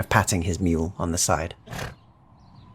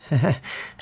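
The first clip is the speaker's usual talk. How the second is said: short nervous chuckle, pitched up; awkward little laugh; 9 semitones higher than usual. Acted. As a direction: laugh off the blank stares awkwardly. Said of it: "awkwardly lets out a little laugh".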